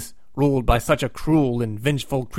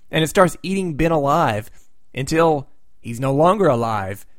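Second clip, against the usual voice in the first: ordinary talk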